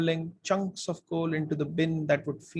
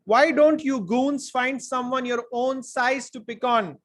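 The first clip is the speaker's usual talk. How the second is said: high, loud, slow confrontation; bold challenge; 7 semitones higher, 5 dB louder than usual. A narrator's reading. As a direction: stand up to bullies, drawing it out. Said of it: "Sarah wasn't shy at all".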